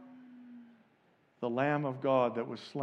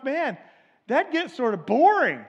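mock bored complaint; 9 semitones higher, 7 dB louder than usual. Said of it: "as somebody told me once".

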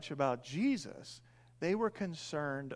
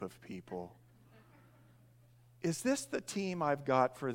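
weary Jesus; 5 semitones lower, 4 dB quieter than usual. Retold or imitated. Jesus complaining to God by phone.

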